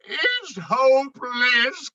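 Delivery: a high, slow, wailing complaint; despairing cry. Acted. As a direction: give up in a whimper. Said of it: "cried Moose".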